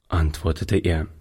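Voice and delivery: ordinary delivery, nothing marked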